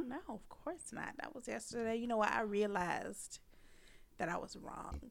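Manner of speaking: soft, soothing mock sincerity; fake apology. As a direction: apologise gently, pretending to be over it. Acts out fake forgiveness.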